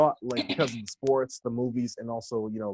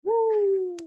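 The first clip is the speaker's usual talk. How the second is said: cheer; 4 dB louder than usual. Real, not acted. Cheering for Auckland.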